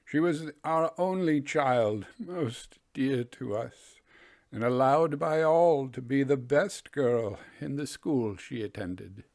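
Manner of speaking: slow, grave voice of a bereaved father; solemn father. Acted. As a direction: tell him gently, heavy with loss. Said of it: "replied the man solemnly".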